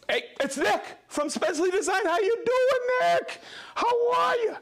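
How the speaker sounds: very high-pitched squealed hello; excited greeting. Pretend. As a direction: greet him in a high, over-the-top squeal. Calling out greeting to arriving viewer.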